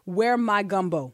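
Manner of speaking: slow, halting read of the text; reading aloud haltingly. Shown as a demonstration. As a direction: drag it out word by word. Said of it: "you don't read it slowly like that".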